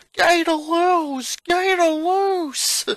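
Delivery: high, loud, slow alarm cry; yelling. A narrator's reading. A bystander warning the crowd, alarmed yell. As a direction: bellow the warning to everyone. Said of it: "someone yelled".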